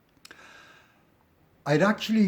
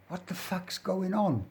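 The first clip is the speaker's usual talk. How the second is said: high, softly voiced London accent; 7 semitones higher, 4 dB quieter than usual. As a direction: imitate a baffled Londoner, London accent pitched up. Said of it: "London accent".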